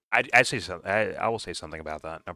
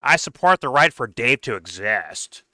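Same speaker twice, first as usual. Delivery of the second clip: loud, slow, higher-pitched mocking paraphrase; mock quoting Nick; 5 semitones higher, 8 dB louder, slower than usual. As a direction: parody his stance in a loud, drawn-out put-on voice. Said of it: "It's essentially what he's saying".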